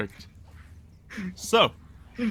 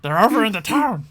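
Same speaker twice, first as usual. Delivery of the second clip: goblin chief; 8 semitones higher than usual. Acted. Goblin chief sends the party to town, urgent then sheepish.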